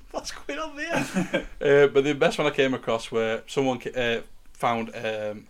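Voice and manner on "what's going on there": laughing squeak. Acted. Laughing through high mock voice.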